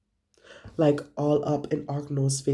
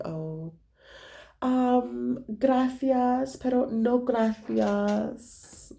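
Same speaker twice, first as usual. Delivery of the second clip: higher, slow, sugary polite no; a drawn-out, higher fake-polite refusal; mock polite refusal; 7 semitones higher, much slower than usual. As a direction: decline sweetly with a fake smile in the voice. Turning down the homeless man.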